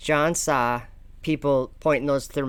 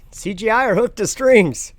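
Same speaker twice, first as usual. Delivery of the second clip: raised, louder amused quote; quoting the astronaut; 9 semitones higher, 8 dB louder than usual. Retold or imitated. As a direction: replay the line with glee. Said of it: "I thought that was funny".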